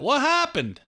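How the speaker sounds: high, squeaky what happened; comic catchphrase. Retold or imitated. Host imitating a TV comedian's catchphrase, high and squeaky. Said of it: "Who's the guy that always says that?".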